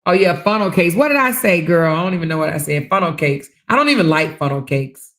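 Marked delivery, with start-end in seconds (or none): none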